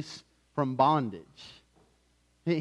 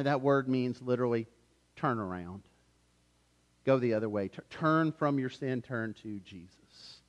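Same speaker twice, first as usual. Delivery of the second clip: usual speaking voice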